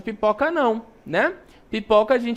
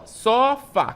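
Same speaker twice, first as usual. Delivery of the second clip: "sofá" split as SO, FÁ, both drawn out; syllable separation; 4 semitones higher than usual. Shown as a demonstration. Teacher separating the word for pupils, "sofá" syllable by syllable. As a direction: break "sofá" into two stretched syllables. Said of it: "quando eu separo".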